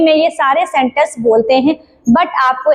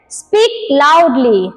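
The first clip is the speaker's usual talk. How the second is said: lower, drawn-out teacher command; slow model phrase; 3 semitones lower, much slower than usual. Shown as a demonstration. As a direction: stretch it like a teacher calling. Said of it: "तो आप इसको बोल सकते हो".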